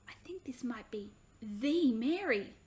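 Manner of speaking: put-on a boy's urgent whisper to his sister. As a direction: whisper so the woman won't hear. Joshua confides secret to sister.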